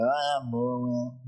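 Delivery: low, drawn-out groan; moaning local. Retold or imitated. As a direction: drag it out like a weary complainer. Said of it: "they talk like that all the time".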